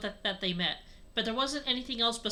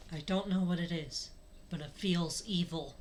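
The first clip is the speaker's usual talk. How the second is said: sensing something dark; uneasy Lucario; 4 semitones lower, 4 dB quieter than usual. Acted.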